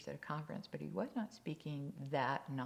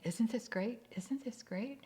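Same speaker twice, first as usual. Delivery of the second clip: higher-pitched, repeated mock delight; acted eager husband, pitched up; insistent enthusiasm; 7 semitones higher, 4 dB louder than usual. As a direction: keep trying to convince your wife it's great. Husband keeps repeating it while driving.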